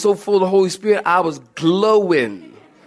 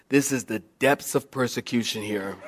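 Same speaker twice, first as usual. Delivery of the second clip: low, hushed inner monologue; mock-solemn musing; 9 semitones lower, 10 dB quieter than usual. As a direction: dwell gravely on your own suffering, tongue in cheek. New convert's solemn self-pitying thoughts.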